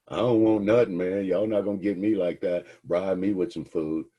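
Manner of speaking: a suspect shouting no deal; defiant suspect. Acted. Defiant suspect refuses bribe.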